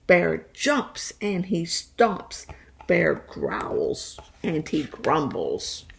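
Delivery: slow, low, growling bear voice; storyteller growling the bear awake; growly bear. Acted. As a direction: rumble it like a grumpy bear. Bear wakes up angry.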